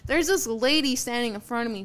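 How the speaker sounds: ordinary talk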